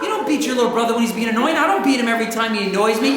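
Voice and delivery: rapid-fire mock scolding; fast, exasperated mom rant. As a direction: rattle off a parent's telling-off.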